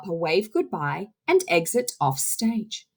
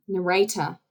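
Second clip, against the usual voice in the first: usual speaking voice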